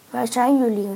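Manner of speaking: loud searching call. A narrator's reading. A stranger looks for the trapped friends, calling out. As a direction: call out to locate them. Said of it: "riep iemand".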